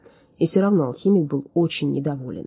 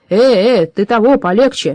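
louder, higher warning objection; giant interjecting; 5 semitones higher, 8 dB louder than usual. A narrator's reading. Giant Dol defending his brother, loud interjection. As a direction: cut in loudly as the giant. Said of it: "вмешался в разговор Дол".

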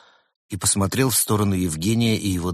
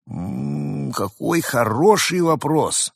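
mock pondering; 7 semitones higher, much slower than usual. Repeats the pondering line.

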